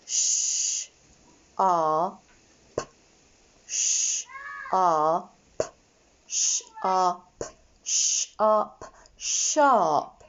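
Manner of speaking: repeated "sh, ah, p" then a slow "sharp"; phonics blending. Shown as a demonstration. Teacher blending sounds into sharp for children. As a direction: sound out each piece, then blend slowly. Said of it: "saying the sound and then blending".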